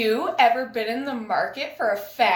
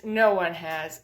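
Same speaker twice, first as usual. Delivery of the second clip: normal talking voice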